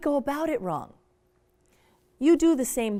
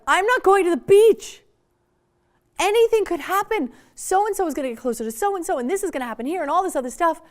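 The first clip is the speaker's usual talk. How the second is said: put-on higher, louder, rushing teen complaint; upset teen outburst; 7 semitones higher, 7 dB louder, faster than usual. Teen telling parent she is missing the beach, loud and upset. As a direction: wail it like a teen left out.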